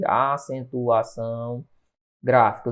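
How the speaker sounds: slow, low and soft; drawn-out teacherly enunciation, dropping low; slow, drawn-out key term. Real, not acted. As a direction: stretch the term syllable by syllable.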